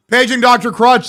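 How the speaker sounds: ordinary delivery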